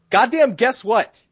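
ordinary talk, nothing marked

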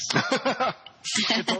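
high laugh. Real, not acted. Laughing at friend's apology.

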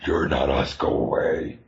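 low, rough-whispered xenophobic creature; hostile creature voice. Acted. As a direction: drop way down to shoo off outsiders. Acting xenophobic creatures shunning outsiders.

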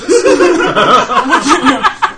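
laughing hard; loud laugh. Real, not acted. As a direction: burst of laughter. Laughing at own story.

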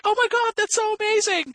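very high, gushing impression; imitated excited wife. Wife in the garden hearing the news, excited. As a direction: gush in a put-on high voice.

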